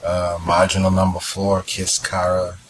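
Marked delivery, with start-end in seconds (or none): none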